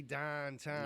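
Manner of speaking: "downtown" drawn out as slow dahn-tahn; Pittsburgh pronunciation. Shown as a demonstration. Joking about accent class, "downtown" said slowly as dahn-tahn. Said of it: "Like, don't say".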